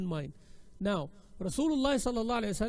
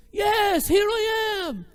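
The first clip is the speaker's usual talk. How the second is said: high, booming shout back; same loud reply; 13 semitones higher, 11 dB louder, much slower than usual. Retold or imitated. Prophet answers the Bedouin in kind, high loud shout. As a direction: holler it back in a drawn-out high pitch. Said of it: "He replied back with the same".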